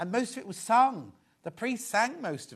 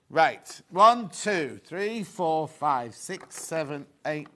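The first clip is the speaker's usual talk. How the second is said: usual speaking voice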